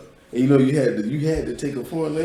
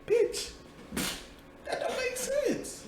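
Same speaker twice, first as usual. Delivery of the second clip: very high, softer squeaky protest; mock exasperation; 9 dB quieter, much slower than usual. Student retells confusion at Spanish page numbers, high mock protest. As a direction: squeal the complaint, half laughing.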